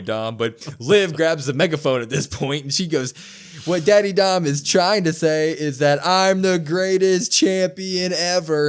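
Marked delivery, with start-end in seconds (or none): 3.6-8.7 slow, drawn-out mock yell, higher and louder; imitating a yell. Host retelling Liv's megaphone promo, loud slow mock yell. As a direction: belt it out like a hammy wrestling promo. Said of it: "I yelled like that because she yelled it like that".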